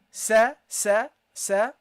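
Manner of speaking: sa said slowly with short open a; Arabic sa. Shown as a demonstration. Teacher demonstrating light sa to students. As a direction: say sa with a light seen and short fatha. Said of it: "you got the seen and the fatha".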